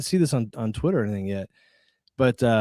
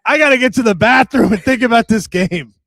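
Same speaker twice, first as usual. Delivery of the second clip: high, loud put-on voice imitating Cap; mock Cap; 9 semitones higher, 10 dB louder than usual. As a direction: squeal it out like a silly impression of a friend. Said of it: "my man Cap's like".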